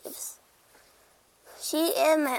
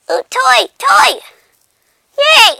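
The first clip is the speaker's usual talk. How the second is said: excited squeal; 11 semitones higher, 15 dB louder, slower than usual. Pretend. Cat spots a toy.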